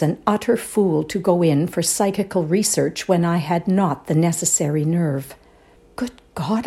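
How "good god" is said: breathless whispered gasp of fright. A narrator's reading. Woman terrified by the ghost, gasped whisper. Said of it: "I gasped".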